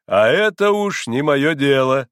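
loud, slow, pompous dismissal; mild sneer. A narrator's reading. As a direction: wash your hands of it grandly. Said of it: "усмехнулся доктор".

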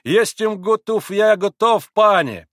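high, loud, fervent protest; crying out. A narrator's reading. Pole assures Grushenka and agrees to play, declaiming loudly. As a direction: declaim it to win her over. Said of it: "крикнул пан на диване".

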